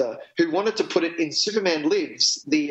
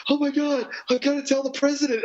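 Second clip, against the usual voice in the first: high mock yell of a panicked character; yelling in a high, hammed-up panic; 10 semitones higher than usual. Friend retelling a movie character's yell, high mock shout. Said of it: "he yells".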